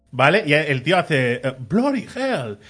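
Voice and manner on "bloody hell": slow, higher-pitched genteel astonishment; politely appalled, drawn-out English exclamation; very polite Englishman. Retold or imitated. Englishman reacting to a yelling woman, very polite. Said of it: "Pero con mucha educación".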